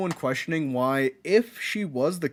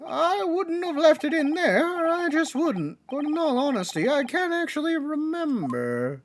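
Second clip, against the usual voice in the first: dithering juror; 10 semitones higher than usual. Acted. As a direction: draw it out high and wavering.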